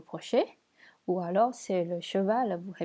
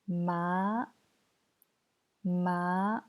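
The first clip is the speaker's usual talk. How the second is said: "Ma" rising slowly to the end; second tone. Shown as a demonstration. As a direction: let each "Ma" climb from mid pitch. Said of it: "deuxième ton".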